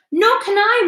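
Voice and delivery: a mild storybook wail. A narrator's reading. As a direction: wail it in despair. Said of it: "wailed the glowworm".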